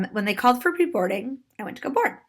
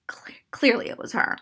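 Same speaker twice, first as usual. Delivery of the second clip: softer, confiding half-whisper; whispered aside; 5 dB quieter than usual. Real, not acted. Storyteller contradicts woman's denial, whispered aside. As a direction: slip it to the audience under your breath.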